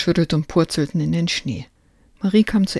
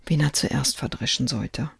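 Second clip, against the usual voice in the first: ordinary delivery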